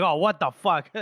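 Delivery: acted startled swearing; startled outburst. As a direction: blurt it out as if jumped from behind. Acted shock, then laughs.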